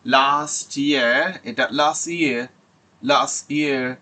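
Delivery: লাস্ট ইয়ার with the t dropped before ইয়ার; linked last year; last year elided. Shown as a demonstration. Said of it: "এলিশন হয়ে যায়".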